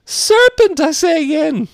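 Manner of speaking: ordinary delivery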